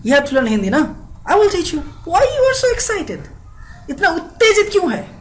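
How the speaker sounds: very high, hammed-up excitement; excited acting. Teasing a friend eager to learn Hindi, acted excitement. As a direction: push the pitch way up, eager and animated. Continues the excited mock dialogue.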